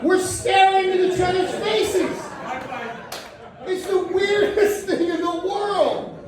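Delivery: high-pitched, drawn-out incredulity; high, slow comic outrage; comic disbelief. Retold or imitated. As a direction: stretch it out high in appalled amazement. Comic outrage at trough setup.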